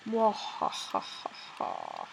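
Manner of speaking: slow villainous mwahaha cackle; evil laugh. Pretend. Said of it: "So evil".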